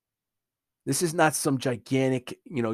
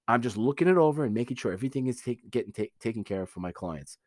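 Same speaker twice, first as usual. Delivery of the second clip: normal talking voice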